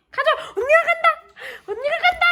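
excited squeal. Real, not acted. Owner running off to make her dog chase, excited laughing squeal.

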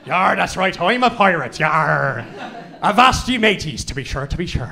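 hammed-up Irish accent pirate voice; a mock Irish pirate growling yar. Mocking the crowd doing a pirate voice, hammed-up Irish accent. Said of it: "put on an Irish accent".

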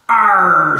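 high, loud pirate arr. Acted. A pirate answering the question with a loud arr. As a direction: bark it like a scallywag. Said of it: "said one of the scallywags".

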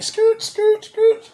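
high, loud skirt skirt skirt; tire screeches. Retold or imitated. Telling how they turned at the light, loud screeching tires.

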